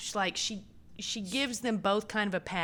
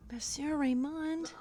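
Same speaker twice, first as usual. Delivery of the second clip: dramatic reveal; 7 semitones higher, slower than usual. Real, not acted. Drumroll reveal of a character.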